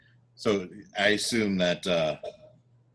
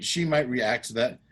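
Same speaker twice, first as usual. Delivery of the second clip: ordinary delivery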